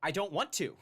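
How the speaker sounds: loud, high-pitched mock refusal; mock complaint, pushed up and loud; mocking mimicry. Mocks the vlogger's reluctance.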